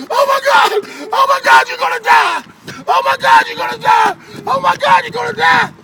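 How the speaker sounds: high, loud panicked shrieks over and over; panicked screaming. Retold or imitated. Big guy shrieking in panic over his bleeding friend. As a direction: scream it like he's watching a friend die. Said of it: "was going".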